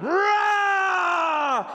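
high, drawn-out shouted line; roaring shout. Acted. The beast roars while backing into the jungle. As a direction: shout it like a roar.

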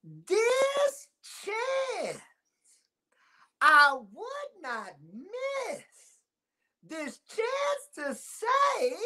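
very high, very slow, held words, near-shouted; grandmother's dramatic recital. Retold or imitated. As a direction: hold every word like an excited grandma. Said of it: "this is how I want you to say it".

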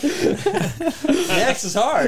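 laughing through a held tongue; slow and garbled; holding tongue with fingers. Shown as a demonstration. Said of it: "Now say Max".

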